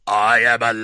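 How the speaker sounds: put-on loud, monotone machine-child voice; Proteus four. Film fan mimics the movie's kid, loud droning Proteus voice. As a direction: drone it out loud on one held note. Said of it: "In the Proteus four voice".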